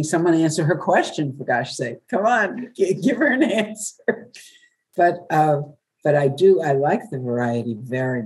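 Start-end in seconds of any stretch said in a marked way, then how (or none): 0.0-4.3 exasperated inner voice. Retold or imitated. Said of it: "because I keep thinking".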